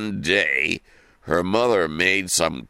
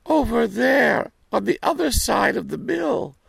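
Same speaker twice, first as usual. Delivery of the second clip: put-on little girl voice. Girl gives the wolf directions.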